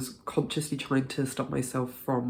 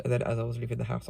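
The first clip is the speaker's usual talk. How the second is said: usual speaking voice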